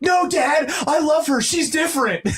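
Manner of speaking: high, pleading mock teen; lovestruck son. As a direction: beg dad like a smitten kid. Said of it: "It's like".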